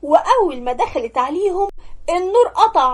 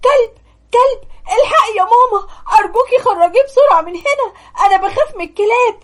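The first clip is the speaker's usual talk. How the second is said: high, loud panicked pleading through sobs; terrified crying; 8 semitones higher, 4 dB louder, faster than usual. Acted. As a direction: scream for mom in terror, crying. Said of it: "اتخضت جدًا وقعدت تعيط وقالت".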